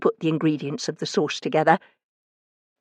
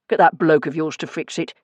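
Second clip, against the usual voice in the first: grumpy. A narrator's reading. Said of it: "he said grumpily".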